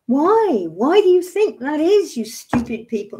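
high, loud exasperated demand; frustrated cry of why; real angry outburst. Real, not acted. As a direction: lash out at everyone who ignored it. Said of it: "I really am in a state today".

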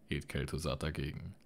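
usual speaking voice

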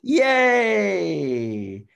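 excited cheer. Real, not acted. Cheering a correct name guess.

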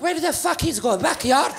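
high-pitched imitation of an indignant mother; mother at the door. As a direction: play Mom exclaiming from the door. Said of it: "my mother's just at the door".

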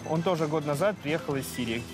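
ordinary talk, nothing marked

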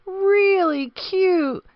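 high, squeaky-bright ostrich gushing, then settling down. Acted. Reading to kids, Ostrich peeks at the baby hippo, high gushing bird voice. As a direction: coo it like a goofy bird peeking at a baby. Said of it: "said Ostrich".

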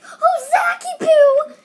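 high, slow fake panic shout; hammed-up frightened cry for help; mock scared cry. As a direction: wail the name like a scared kid. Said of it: "he was acting all scared".

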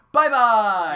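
loud, high farewell holler; shouted sign-off. Pretend. Host ending the episode for viewers, exuberant shout. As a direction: call it out big to the audience.

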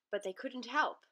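usual speaking voice

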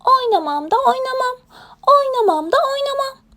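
high-pitched obstinate chant; stubborn hedgehog refusal. Acted. Hedgehog refuses to play, high stubborn chant. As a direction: dig in with a high, whiny sing-song. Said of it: "tutturdu bizim kirpi oynamayacağım diye".

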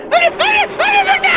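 very high frantic shriek; panicked scream. Real, not acted. Gamer begging a door to open, panicked shriek. Monster coming, door shut.

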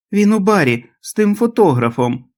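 high, piercing voice of a sick mother; shrill wail. A narrator's reading. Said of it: "Її вискливий голос пронизав".

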